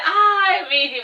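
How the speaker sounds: high, swooning fond sigh; fond and dramatic. Acted. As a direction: gush it out, high and dreamy. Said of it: "Like, she said".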